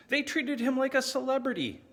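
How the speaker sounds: high-pitched, indignant disbelief; scornful exclamation. Acted. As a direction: pitch it way up, appalled by the fuss. Scornful account of a rival's popularity.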